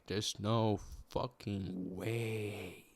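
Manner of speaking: very slow, stretched-out words; drawn-out disbelief. Retold or imitated. Recalling their reaction to the paper they read, drawn-out disbelief. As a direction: drag out each word in disbelief.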